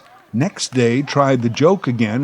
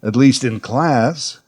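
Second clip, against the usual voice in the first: usual speaking voice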